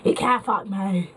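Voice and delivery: put-on goading drunken jeer, climbing at the end; imitated tipsy taunt, insistent and repetitive; tipsy friend taunting. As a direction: goad them like a drunk friend. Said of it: "she's just like".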